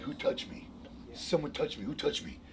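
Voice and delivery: soft, almost whispered puzzlement; hushed. Retold or imitated. Jesus, sensing healing power leave him, asks quietly. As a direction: wonder aloud under the breath.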